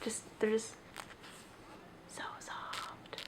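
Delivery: soft unvoiced whisper; near-silent breathy whisper. Real, not acted. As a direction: hush so the pages can be heard. Whispering about soft pages.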